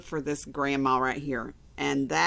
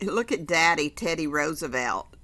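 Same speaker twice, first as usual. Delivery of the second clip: plain reading voice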